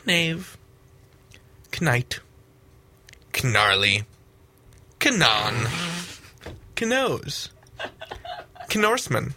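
deadpan word list, every "Kn" said "kuh-n", as in "Knutcracker"; Viking K-N words. Shown as a demonstration. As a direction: sound the K before the N, as in "kuh-nee" for "Knie". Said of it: "begins with a K N sound".

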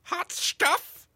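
high, teasing villain voice firing toy lasers; cheesy villain flirt. Acted. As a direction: croon it then go pew pew.

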